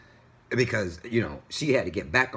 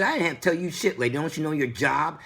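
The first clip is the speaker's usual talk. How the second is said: scolding the driver, raised pitch; angry retort; 8 semitones higher, 3 dB louder, faster than usual. Retold or imitated. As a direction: let her have it, fed up. Telling off the driver.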